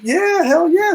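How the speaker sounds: very high hell yeah; hyped. Retold or imitated. Narrator accepts helicopter ride, very high and hyped. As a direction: jump at the chance.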